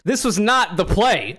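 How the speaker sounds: normal talking voice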